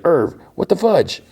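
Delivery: high-pitched imitated disbelief; shocked. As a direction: protest like a stunned coworker. Friend shocked by Irving's attack.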